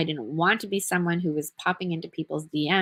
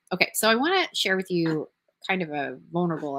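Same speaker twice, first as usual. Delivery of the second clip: usual speaking voice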